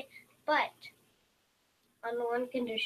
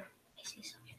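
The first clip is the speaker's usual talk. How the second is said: hushed whisper, barely breathed; 18 dB quieter than usual. Pretend. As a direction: whisper so the goblin won't hear. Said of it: "Oh, no".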